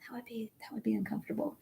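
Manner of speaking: soft unvoiced joke; whispered aside. Real, not acted. Teacher whispers a joke to the class about the sitting dog.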